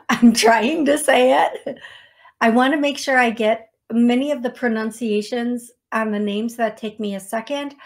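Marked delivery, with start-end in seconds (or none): 0.1-1.8 loud, slow, through laughter; laughing. Real, not acted. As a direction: laugh helplessly while getting it out. Laughing while struggling with a name.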